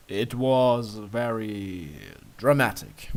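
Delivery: slow, slightly flustered reply; flustered comment. A narrator's reading. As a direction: say it dryly, a bit rattled. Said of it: "you comment, still a little flustered".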